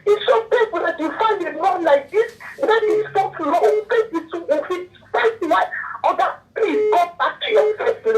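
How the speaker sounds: high, tearful pleading that breaks into crying; crying while pleading with the husband; real tears straining the voice high. Real, not acted. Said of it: "I know I'm crying as I'm talking to you".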